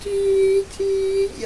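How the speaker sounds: high squeaky tee tee; squeaky winch noise. Retold or imitated. Imitating tiny robot winch.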